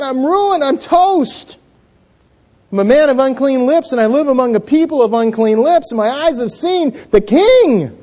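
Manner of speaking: high, anguished wail of a doomed man; crying out in dismay. Acted. Isaiah before the throne of God, crying out in dismay. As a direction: cry it out high, stretching the big words. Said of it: "he cries out".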